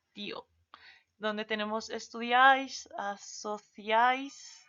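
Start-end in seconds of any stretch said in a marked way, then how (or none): 1.9-4.6 "asociáis" in a Spain accent, with a lisped theta for the c; Castilian "asociáis" said very slowly with a rising end; Spain Spanish. Shown as a demonstration. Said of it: "la pronunciación es diferente".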